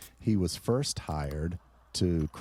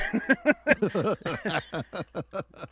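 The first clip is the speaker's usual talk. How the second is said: long high laugh; amused laughter; 11 semitones higher than usual. Real, not acted. Laughs at co-host's slip.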